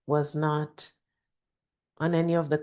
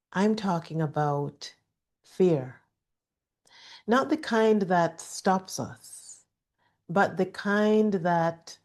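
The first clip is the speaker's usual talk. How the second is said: usual speaking voice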